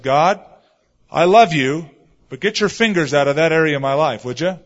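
loud, insistent appeal to God; forceful, exasperated retold prayer; exasperated plea. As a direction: plead loudly for God to back off. Pleading with God to back off.

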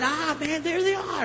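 high-pitched, excited relief on finding them; excited discovery. Retold or imitated. Customer spots burritos in the dairy, excited. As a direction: exclaim it high, delighted at the find.

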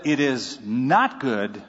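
"not" leaned on, slow and grave; slow it down and weigh the word "not"; "It is not good" said slowly, with "not" leaned on; stress on not. Retold or imitated. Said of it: "and God said".

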